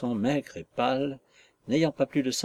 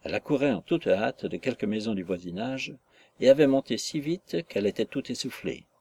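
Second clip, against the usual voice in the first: ordinary delivery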